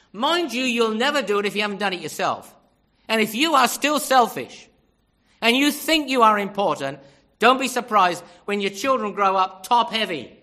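loud, emphatic warning from the pulpit; forceful preaching. Real, not acted. Preacher warns parents about their own selfishness, forcefully. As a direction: drive the warning home at full voice.

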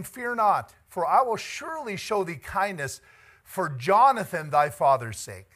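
high, loud proclaiming; preacher booming King David's kindness. Acted. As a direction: declare the king's promise with force. Reading David's words.

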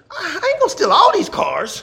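high-pitched comic protest; mock indignant protest. Pastor joking about being judged in a parking lot, mock indignant. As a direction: protest as if wrongly suspected, played for laughs. Acts out his indignant reaction.

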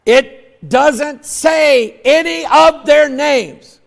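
emphatic sermon, ringing out; preaching at full force. Real, not acted. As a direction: drive each phrase home to the congregation.

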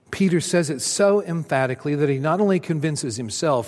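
normal talking voice

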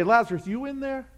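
hesitant, half-asking call into the tomb; tentative knock-on-the-door voice; unsure peek into the tomb; timid question. Shown as a demonstration. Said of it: "He didn't say".

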